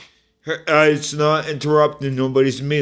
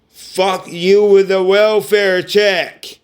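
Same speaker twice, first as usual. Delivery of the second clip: slower and louder, spitting each word; higher, slow, fed-up and furious; angry outburst; 5 semitones higher, 3 dB louder, slower than usual. Real, not acted. Cursing out family over the welfare checks, loud and slow.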